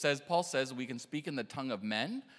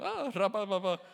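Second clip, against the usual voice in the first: mock freaked-out babbling, higher at the start; panicked tongues imitation; 5 semitones higher than usual. Young man praying with eyes closed, freaking out in mock tongues. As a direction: babble nonsense syllables in playful panic. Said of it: "'cause he's freaking out".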